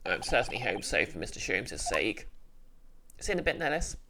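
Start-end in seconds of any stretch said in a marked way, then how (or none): 0.1-2.3 low voice, puzzled; a deep-voiced young man thinking aloud; puzzled male sidekick. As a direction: sidekick voice, pitched low and wondering. Male character voice.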